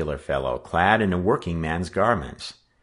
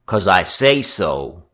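slow, loud assertion; heavy, bossy emphasis; domineering; 6 dB louder, slower than usual. Acted. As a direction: lay down the law slowly. Gang leader lays down law.

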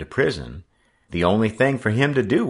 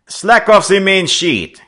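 put-on high, loud French-accented order; calling out; 13 semitones higher, 10 dB louder than usual. A narrator's reading. French skipper ordering his crew from the cabin, calling out. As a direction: sing out a command across the boat. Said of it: "he called out".

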